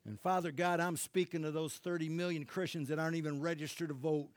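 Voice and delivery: a spoken prayer that bursts loud on be alert. Acted. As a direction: pray it out, then call them to wake. Prays over the nation.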